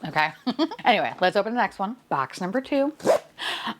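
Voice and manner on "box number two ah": ordinary delivery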